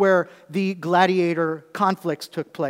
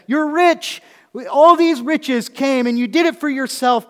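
high, loud mock admiration; mock their riches; Paul sarcastically praising the Corinthians; sarcastic; 8 semitones higher, 8 dB louder than usual. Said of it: "Paul is using, uh, sarcasm here".

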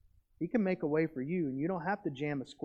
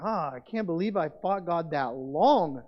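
relieved exclamation; 7 dB louder, slower than usual. Retold or imitated. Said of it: "and you're like".

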